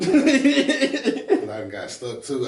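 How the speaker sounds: very slow, laughing it out; words stretched through a laugh. Real, not acted. Laughing at his own slip.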